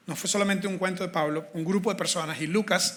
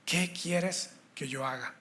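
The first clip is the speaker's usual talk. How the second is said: soft, slow, surrendered question; humble plea; 2 semitones lower, 8 dB quieter, much slower than usual. Shown as a demonstration. Preacher voicing Paul's humble surrender, hushed. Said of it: "Una tercera vez, la última es la vencida".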